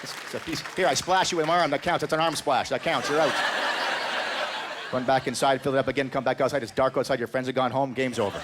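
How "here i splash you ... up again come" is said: high, loud playground taunt; excited kid at play. Pretend. Kid telling his friend the arm splash counts, excited and loud. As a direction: shout it like a kid claiming a hit. Kid playing water pistol game.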